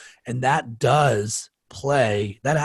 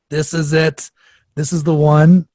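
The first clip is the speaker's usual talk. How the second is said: loud, raised declaration; mock storming in; 5 semitones higher, 8 dB louder than usual. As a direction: burst in announcing your final stand. Imagines him storming into an office.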